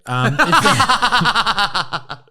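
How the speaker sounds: high, loud laughing through the words; real laughing. Real, not acted. Host answering insult to his accent, laughing hard. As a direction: crack up while trying to answer.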